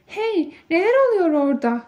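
suddenly surprised by the crowd. A narrator's reading. Louis turns back, surprised by the noise. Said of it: "dedi şaşkınlıkla".

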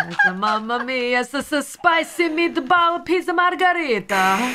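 mock Italian accent. Joking about Italian pizza lover.

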